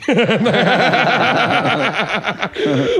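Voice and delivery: bursts of high laughter; laughing. Real, not acted. Comedian laughs at his own drunk story. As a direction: laugh at the memory.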